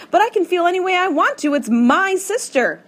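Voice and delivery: high, loud, hurt protest; upset outburst. A narrator's reading. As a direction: push back at Tom, near tears. Upset girl snaps at Tom.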